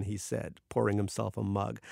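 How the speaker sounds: usual speaking voice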